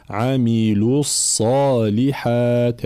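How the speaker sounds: slow recitation, عاملوا with a fully rounded "lu" into الصالحات; full damma on lam. Shown as a demonstration. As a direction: join عاملوا الصالحات, short rounded u before the heavy ص. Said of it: "ضم اللام وإتمام حركة الضم".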